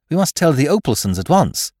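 high, loud, urgent insistence; urgent appeal. Acted. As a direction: urge them to act right away. Companion urgently urges action.

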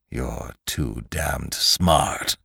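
low, very slow, soft menace; menacing Lantry. Acted. Lantry confronts the man who found him out, menacing. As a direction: draw each word out low and threatening.

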